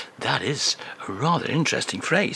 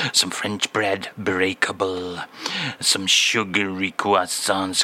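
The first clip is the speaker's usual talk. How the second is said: [name]; 5 semitones lower than usual. Acted. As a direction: put on a thick French accent, unhurried. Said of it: "[name]".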